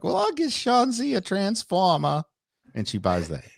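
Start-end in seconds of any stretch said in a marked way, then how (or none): 0.0-2.2 high old-lady impression; lightly voiced grandma. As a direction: play a sweet grandma offering a gift. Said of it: "your grandma's like".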